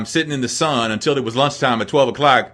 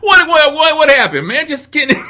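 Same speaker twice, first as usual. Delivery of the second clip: laughing mock shock; 14 semitones higher, 5 dB louder than usual. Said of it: "I was like".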